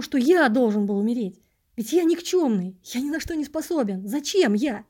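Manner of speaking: impassioned cry of self-doubt; near despair. A narrator's reading. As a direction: pour out the doubts desperately. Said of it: "Почти в отчаянии вскрикнул я".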